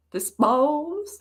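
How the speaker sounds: "Dispose" with an extra drawn twang on the vowels; exaggerated twang. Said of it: "I gave that extra twang".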